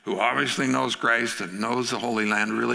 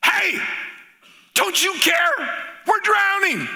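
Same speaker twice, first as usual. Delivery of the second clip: high, slow, loud panic; panicked shout; 4 dB louder, slower than usual. Acted. Disciples waking Jesus in the storm, panicked shout. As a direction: yell for help in terror. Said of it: "That's how they said it".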